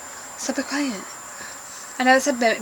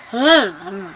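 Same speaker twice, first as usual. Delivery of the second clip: imitating brother's hmm; 6 semitones lower, 4 dB quieter than usual. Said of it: "He talks like that".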